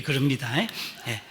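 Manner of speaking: ordinary talk, nothing marked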